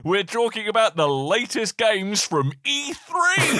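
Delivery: hyped host read. Acted. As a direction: draw out the big announcement. Reads AI intro as hyped host.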